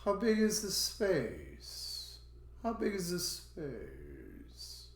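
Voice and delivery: slow question, "space" swooping down; drawn-out teacher demo falling on "space"; rising-falling intonation. Shown as a demonstration. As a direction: raise the pitch, then drop long on "space".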